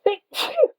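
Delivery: sudden high sneeze; sneezing. Real, not acted. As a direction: let out a sharp, high sneeze. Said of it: "I'm gonna sneeze".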